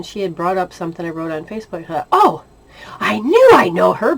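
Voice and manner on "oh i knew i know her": surprised recognition. Retold or imitated. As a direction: jump up in pitch as it clicks. Sudden recognition of a woman.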